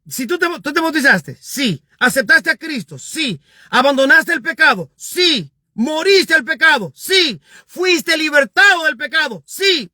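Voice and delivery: high, insistent preacher's questioning, each answered yes; rousing question-and-answer. Acted. Preacher acting out Paul questioning the baptized believer, rousing and insistent.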